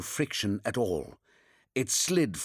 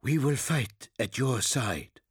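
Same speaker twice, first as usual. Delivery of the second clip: tired but resolute soldier; exhausted. Acted. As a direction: spent voice, still holding firm. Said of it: "He sounded exhausted".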